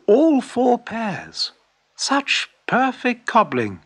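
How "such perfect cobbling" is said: higher, slow, admiring exclaim; admiring customers. Acted. Customers admiring the shoemaker's wares, slow admiring exclaim. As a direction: savour each word in admiration. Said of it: "exclaimed the customers".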